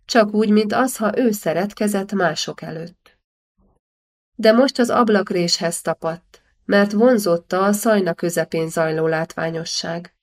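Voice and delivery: plain reading voice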